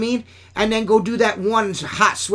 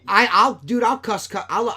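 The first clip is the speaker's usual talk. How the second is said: ordinary delivery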